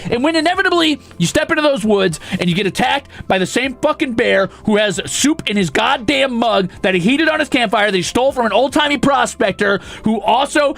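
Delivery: high, heated rant that climbs; building rant. Real, not acted. Ranting at chat, rising tirade.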